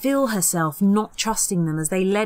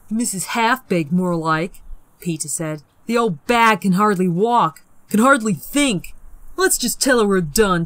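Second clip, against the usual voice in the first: louder scornful jibes; mocking scorn; 4 dB louder than usual. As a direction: sneer at the old woman. Peter mocking old lady.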